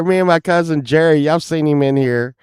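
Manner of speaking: ordinary talk, nothing marked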